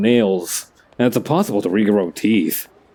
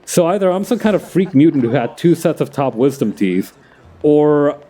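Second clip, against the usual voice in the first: ordinary talk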